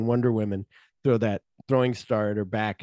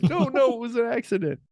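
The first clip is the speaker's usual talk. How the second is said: squeaky high protest; mock pleading; 12 semitones higher than usual. Killer pleads with the other guy, squeaky high denial.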